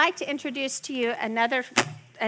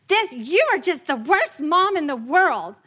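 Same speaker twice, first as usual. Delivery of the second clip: mock angry kid; 5 semitones higher, 6 dB louder than usual. Angry daughter yelling on stairs.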